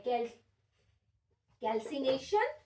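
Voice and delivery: ordinary talk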